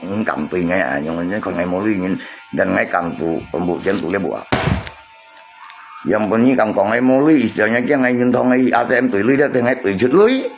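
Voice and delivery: ordinary talk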